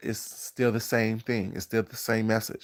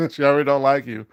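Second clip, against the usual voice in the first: louder and higher, laughing tease; loud teasing; 4 semitones higher, 9 dB louder than usual. Real, not acted. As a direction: push the repeat up with a laugh.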